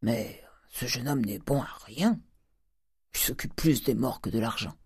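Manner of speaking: soft grumbling contempt, in a put-on voice; disdainful miser. Miser judging his grieving nephew worthless, muttering scornfully. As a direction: grumble it under your breath with disdain. Avare méprise le chagrin du neveu.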